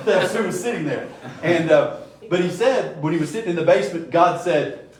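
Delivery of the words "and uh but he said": ordinary talk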